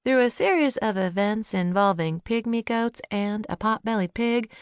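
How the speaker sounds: ordinary delivery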